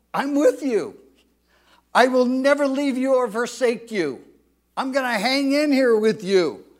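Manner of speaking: fervent preacher voicing God to the couple; voicing the Lord. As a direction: promise them you won't leave. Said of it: "He's telling us".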